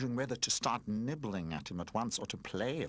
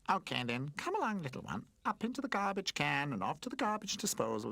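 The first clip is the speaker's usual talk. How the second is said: gloating garbage can; 6 semitones higher than usual. Acted. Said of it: "laughed Mumph".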